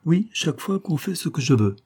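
put-on high-pitched, very decided girl's answer; crisp and resolute. A narrator's reading. Young girl answering Jacques's teasing question, crisp and resolute. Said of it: "un petit accent très net et très résolu".

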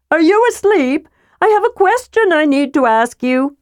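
put-on eager, chirpy young kender; cheerful kender voice. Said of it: "cried a cheerful voice".

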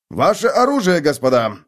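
high, loud order; a guard ordering two prisoners to disarm; commanding order. Acted. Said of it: "приказал стражник".

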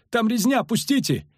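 high, pleading shout; panicked cry. A narrator's reading. Soldier begs to flee the slaughter, crying out. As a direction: beg in alarm to get past. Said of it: "крикнул кнехт".

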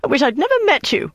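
high, bitter outburst; hurling it at him; furious woman's high parting shot. Acted. Denise furious after insult.

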